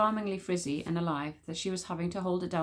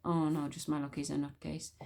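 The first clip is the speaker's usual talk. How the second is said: muttered thought; 4 semitones lower, 7 dB quieter than usual. Acted.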